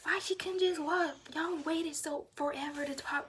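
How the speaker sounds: exasperated mother complaining; mad mom. Retold or imitated. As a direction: play the fed-up mom scolding the staff. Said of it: "So mom was mad".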